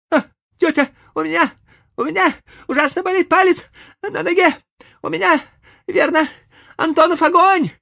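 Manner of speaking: very high, loud theatrical wail; milk the fake suffering; hammed-up dying boy. A narrator's reading. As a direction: Tom pretending to be ill. Tom feigning agony.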